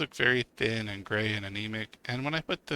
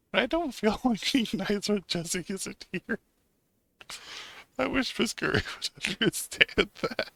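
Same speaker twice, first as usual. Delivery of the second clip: laughing while reading; 8 semitones higher than usual. Real, not acted. Laughing while reading game text.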